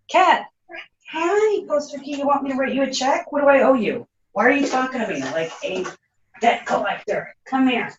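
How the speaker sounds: high, sweet voice for the cat; pet talk. Real, not acted. Owner talking to her cat in a high sweet voice.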